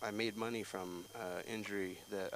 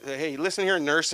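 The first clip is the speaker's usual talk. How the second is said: loud, higher warning; sharp, raised call-out; confrontational; 5 semitones higher, 10 dB louder than usual. Acted. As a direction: square up to the nurse. Confronting the man mocking his dad.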